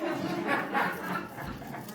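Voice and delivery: a sudden high burst of laughter; loud laugh. Real, not acted. To the room, laughing at her own joke. Joke about not listening.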